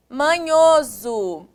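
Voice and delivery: MANHOSO slow, syllables drawn out; slowly sounded out. Shown as a demonstration. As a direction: stretch out "manhoso" for the class. Teacher sounds out the word. ("manhoso" devagar, com sílabas alongadas)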